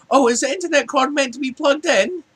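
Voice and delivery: high-pitched mock puzzlement; clueless customer. Clueless customer answering a support agent's question, puzzled. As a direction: ask it high, as if it's news to you.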